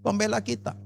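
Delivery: loud, declaiming Bible reading with rising fervour; fervent scripture reading. Acted. Preacher reading Romans to the congregation, loud and fervent. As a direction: proclaim every question as a challenge to the church.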